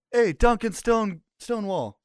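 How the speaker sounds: high, loud call up to the wall. Acted. Player calling up to a guard on the wall, loud call. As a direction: shout his name up to the guards. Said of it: "I'm gonna call out".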